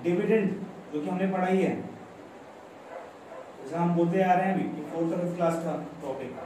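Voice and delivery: usual speaking voice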